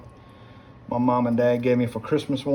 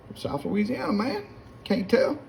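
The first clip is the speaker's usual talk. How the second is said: high-pitched put-on comeback; acted-out reply; 10 semitones higher than usual. Newcomer answering where he is from, high acted reply. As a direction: play your old self answering, pitched up high.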